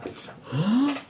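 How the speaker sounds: high theatrical gasp; shocked gasp. Acted. Townspeople seeing Naomi return, gasp of enjoyed shock. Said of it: "shocked but really enjoying being shocked".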